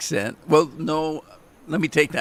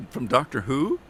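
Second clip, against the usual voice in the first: ordinary talk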